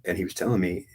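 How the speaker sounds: ordinary delivery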